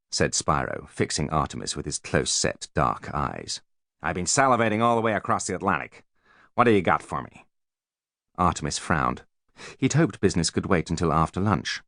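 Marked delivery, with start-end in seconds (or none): 4.0-7.5 a hint of a brash American; brash American businessman. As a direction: gush with slick flattery. Voices Spiro.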